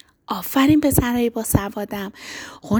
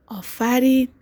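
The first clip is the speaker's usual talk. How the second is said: normal talking voice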